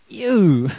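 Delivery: a loud disgusted "ew". Pretend. Preacher picturing the son's pigsty smell, loud disgusted "ew". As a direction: recoil at the stink with a loud "ew". Imagining son smelling of pigs.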